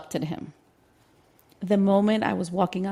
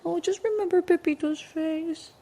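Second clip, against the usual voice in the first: coaxing partner; 10 semitones higher than usual. Retold or imitated.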